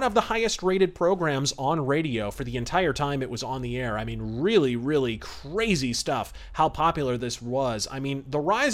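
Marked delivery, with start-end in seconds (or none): none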